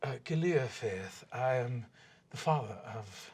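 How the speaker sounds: lightly voiced stiff elven father, solemn and archaic; a hint of a courtly father; formal elf father. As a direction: speak as a proud old elf lord offering thanks.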